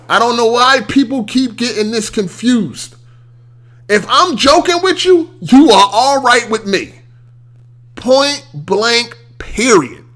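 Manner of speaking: ordinary talk, nothing marked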